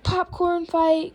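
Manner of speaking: high, loud, drawn-out rallying call; storybook holler; crying out. Acted. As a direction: call it out slowly like a battle cry. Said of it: "cried Froggy".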